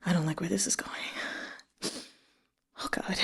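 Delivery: hushed whisper of dread; real dread whisper. Real, not acted. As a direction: whisper it, afraid of what comes next. Reader dreading the next scene.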